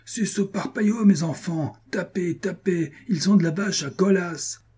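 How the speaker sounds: clamouring. A narrator's reading. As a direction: egg the household on to attack. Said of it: "Ledoux continuait ses clameurs".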